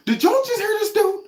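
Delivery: high incredulous disbelief, pitch shooting up; can you believe this guy. Real, not acted. Vlogger to his viewers, high incredulous disbelief. Mocking reaction to garbled speech.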